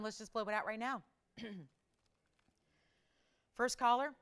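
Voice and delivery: ordinary talk, nothing marked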